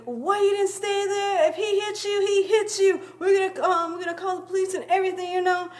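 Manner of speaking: high imitated scolding, rushed at the end; mom yelling. Mom yelling at her daughter about the crash, high mock scold. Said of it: "like, yelling at me".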